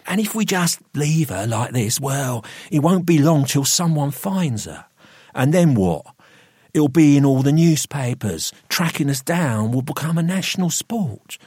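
high, urgent, forceful arguing; heated, pressuring persuasion; domineering Gregory, insistent. A narrator's reading. Gregory argues the consequences.

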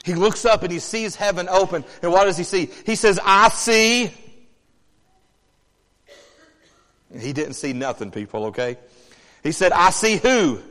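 loud, high preacher's cadence; full-force sermon delivery; fiery preaching. Acted. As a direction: preach it with rising fire.

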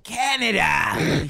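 friend hams up the character's teasing of "Canada"; mocking tease. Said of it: "he teased".

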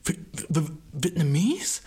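guy stunned at being called Vietnamese, stammering. Retold or imitated. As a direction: stammer it out in shock. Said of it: "er war so erstaunt".

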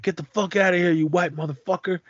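a hint of street menace, retold; retold angry taunt. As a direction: toss the insult like a thug from the movie. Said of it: "and like".

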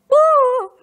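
a sudden high, loud whoop; overjoyed whoop. Retold or imitated. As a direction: let it burst out, overwhelmed. Overwhelmed, retelling emotional moment.

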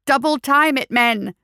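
high, loud drill order; theatrical officer's yell; dramatic shout. A narrator's reading. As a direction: hurry the troops along with flair. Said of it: "as much dramatic flair as he could summon".

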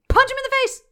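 sudden high, loud, fast burst; excited shout. Retold or imitated. Host recapping the fight for co-host, excited shout. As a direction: shout the punch like a fight climax.

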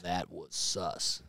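low, slow, soft mock game announcer; Halo guy impression. Host imitates the Halo guy from his soundboard. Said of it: "here's a good one".

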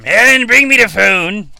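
calling out. Real, not acted. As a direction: shout for your wife to bring the phone. Calling to wife offstage.

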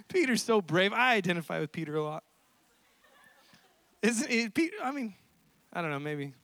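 ordinary talk

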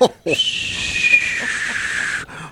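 long airy falling-bomb noise made by mouth; imitated bomb sound. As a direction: mimic a heckler's drawn-out bomb drop. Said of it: "he starts making a bomb sound".

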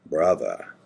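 low, drawn-out Australian greeting; lightly imitated Australian accent; Craig's Australian accent. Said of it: "in that Australian accent".

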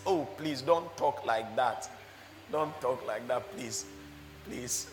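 low, soft mock pleading; emotional pleading. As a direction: plead softly, imitating an upset believer. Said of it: "receives it with his emotion".

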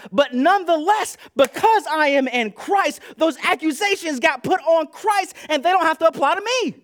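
loud, high preaching; full-force preaching. Real, not acted. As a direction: preach it out over the crowd.

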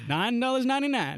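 laughing, high ad-man price call; infomercial. Pretend. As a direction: announce the price like a TV ad. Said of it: "kinda sounded like an infomercial".

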